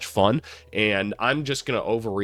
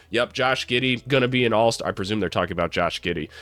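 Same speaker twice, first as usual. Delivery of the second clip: ordinary delivery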